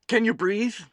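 high, loud, urgent call; shouted question. A narrator's reading. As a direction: call it out to someone who nearly drowned. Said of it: "He was shouting something".

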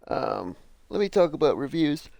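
talking through a real yawn mid-stretch. Real, not acted. Host yawning while introducing the reviews segment, voice stretched by the yawn. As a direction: let the words stretch out on a big yawn. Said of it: "after waking up yawns when you fucking stretch".

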